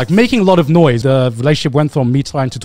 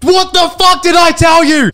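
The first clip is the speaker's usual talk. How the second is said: much higher, furious shout, much louder; imitating an angry yell; 7 dB louder than usual. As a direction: explode at the neighbors in a rage. Angry neighbor storms downstairs.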